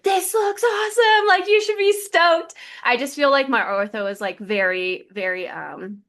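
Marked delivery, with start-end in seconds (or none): 0.0-2.5 much higher, louder, sing-song gushing; gushing physical therapist. Retold or imitated. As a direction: hype her up like a delighted therapist. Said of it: "and she was like".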